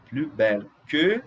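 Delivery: "plus" said as "plu" before belle; silent S. Shown as a demonstration. Teacher giving French example, "plus" with silent S. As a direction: drop the final S of "plus". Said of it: "Eu não vou pronunciar o S".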